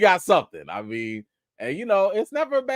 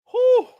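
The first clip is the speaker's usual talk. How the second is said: relieved whew; 5 dB louder than usual. Real, not acted.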